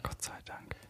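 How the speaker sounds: whispered aside; whispering. Real, not acted. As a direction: breathe it out as a relieved whisper. Relief aside after Haneke.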